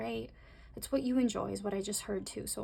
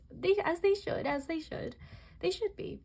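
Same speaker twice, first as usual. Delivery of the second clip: high, singled-out aside of approval; playful approval; 8 semitones higher than usual. Real, not acted. Playful approving aside on others' attraction.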